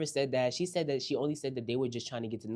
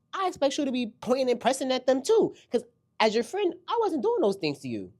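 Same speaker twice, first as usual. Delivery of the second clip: high, loud heated outburst; heated rant; 11 semitones higher, 7 dB louder than usual. Acted. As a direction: let it rip as Heavenly. Ranting as if Heavenly.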